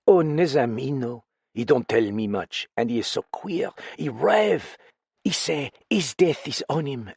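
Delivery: frightened foreign woman confiding in a friend; lightly French-tinged voice, uneasy and pleading; accented woman character. Acted. Same character voice.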